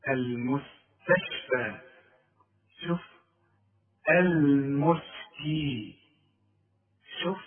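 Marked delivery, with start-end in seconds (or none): 0.1-1.8 المستشفى very slowly, lower, with light s and t; light model word. Shown as a demonstration. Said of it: "على وزن إيه؟". 4.1-5.9 المسكي extremely slow and thin-sounded; light m and s. Shown as a demonstration. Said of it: "شوف مرققة الميم والسين إزاي؟".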